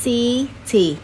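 CI-ty very slow, the first syllable held and stressed. Shown as a demonstration. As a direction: split "city" in two, weight on "ci". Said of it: "đánh dấu nhấn ở số một là city". (đọc "city" thật chậm, nhấn âm tiết đầu)

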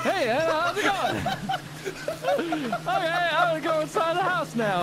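very high, giggly fright; nervous laughing. Real, not acted. Greeting the demon Anna while fleeing, high nervous laughter. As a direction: squeal a greeting through panicked laughter.

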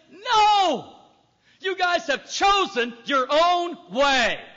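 loud, high stern no; God refusing. Pretend. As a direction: slam the door on them, slow and firm. God's angry reply, voiced.

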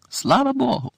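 pitched high, a rising cry of relief. A narrator's reading. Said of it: "почули вдалині крик".

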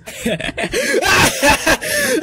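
high, wild villain laugh; evil cackle. Acted. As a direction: cackle like a mad ringmaster.

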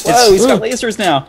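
loud, high startled exclamation; alarmed surprise. Real, not acted. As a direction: blurt it out in alarm. Boss fires lasers, alarmed.

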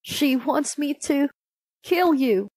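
reluctant, mildly sad confession; understated sad huntsman; said sadly. A narrator's reading. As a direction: break it haltingly, with a touch of sorrow. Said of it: "said the huntsman sadly".

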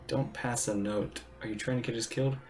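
usual speaking voice

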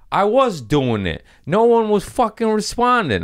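loud, high-pitched protest; exasperated retort. Retold or imitated. Comedian answering a late request, loud exasperated protest. Exasperated reply to audience member.

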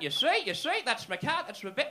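put-on high, loud insistent old-guy voice; mock agitated old man. Old man showing his benefits card, mock agitated insistence.

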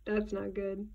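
usual speaking voice

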